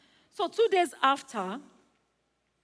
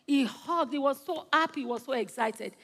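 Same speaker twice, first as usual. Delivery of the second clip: ordinary delivery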